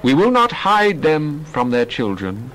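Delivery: ordinary delivery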